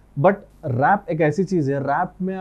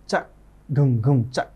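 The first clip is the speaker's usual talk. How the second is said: beatbox kick and snare; 3 semitones lower, slower than usual. Shown as a demonstration. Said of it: "उसको दोनों को ऐसा घुम".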